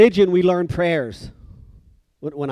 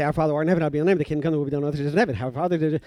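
speed-mumbled recital, words blurring together; rushed rote prayer; much faster than usual. Retold or imitated. Kid racing through his penance, gabbled by rote. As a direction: reel it off as fast as possible. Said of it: "like, eight seconds".

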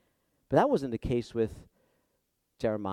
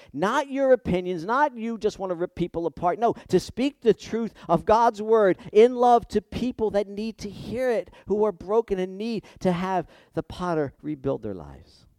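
lifts into forceful pulpit delivery, a bit higher; rising preaching; 6 semitones higher, 6 dB louder than usual. Acted. Preacher urging church to speak truth, forceful. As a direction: push the point home to the congregation.

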